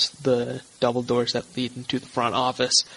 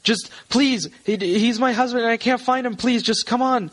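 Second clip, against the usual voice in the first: very high, frantic pleading; distressed woman; 10 semitones higher than usual. Acted. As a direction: implore as a panicked wife. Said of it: "she seems distressed".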